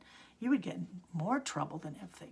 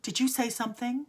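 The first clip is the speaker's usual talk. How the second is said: sharp, higher and louder demand; teacher's sharp question; 5 semitones higher, 7 dB louder than usual. Retold or imitated. Teacher to the silent girl, a sharp surprised question. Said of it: "looked at me and said".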